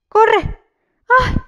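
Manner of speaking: yelling run in sudden fright; high frantic cry to flee; panicked shout. Real, not acted. Frenetic zombie chasing her.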